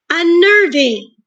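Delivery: Unnerving drawn out high and loud, syllable by syllable; slow syllables. Shown as a demonstration. Teacher to class, testing beats, spoken slowly and high. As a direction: pull each beat of Unnerving apart. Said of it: "There's three sounds".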